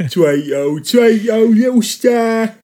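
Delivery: high, loud and slow, a Cockney gangster sliding into French; mangled Cockney-French accent. Pretend. A friend sings a karaoke request in a mangled accent. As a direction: croon it in a broken mock accent. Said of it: "tried to start with the ... Cockney gangster".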